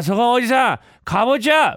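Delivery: long, held holler at high pitch; bellowed street cry. Acted. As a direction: yell it out like a clueless vendor. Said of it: "人捕まえてかぼちゃって".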